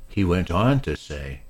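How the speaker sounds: usual speaking voice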